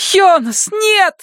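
very high, alarmed shout; panicked high-pitched yell; desperate cry. A narrator's reading. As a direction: cry out to stop him, too late. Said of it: "запоздало крикнула Пейтон".

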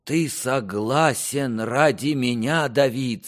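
faltering, tearful. A narrator's reading. King asks son with tears in his eyes, faltering. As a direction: implore him with a touch of tears. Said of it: "сказал король прерывающимся голосом и со слезами".

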